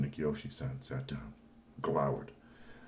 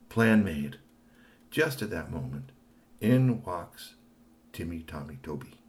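plain reading voice

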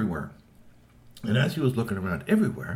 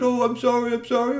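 much higher and louder, hurried sorry; apologetic character voice; 14 semitones higher, 7 dB louder than usual. Acted. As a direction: gush out the apology in a high voice. Character apologizing in retold scene.

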